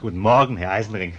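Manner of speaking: kräftig und laut grüßen; laut in den Raum rufen; lauter Gruß. Acted. Biedermann begrüßt die Gäste laut. (laut und herzlich grüßen)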